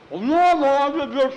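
high, loud mouth-full protest; choking on food. Acted. Jackie denying to Tom that he's choking, mouth crammed. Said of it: "You're gonna choke yourself to death".

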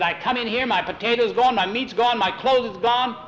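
high, loud list of grievances; indignant complaint. A narrator's reading. Tom complains to the steward about missing things, indignant.

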